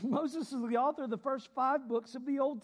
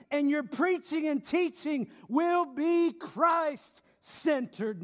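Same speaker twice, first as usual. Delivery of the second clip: loud, higher, slow preaching; full-force preaching; 6 semitones higher, 5 dB louder, slower than usual. Real, not acted. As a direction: drive the point home from the pulpit.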